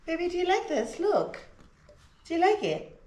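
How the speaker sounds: high, cooing pet voice. Real, not acted. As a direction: coo the question at the puppy. Asking her dog.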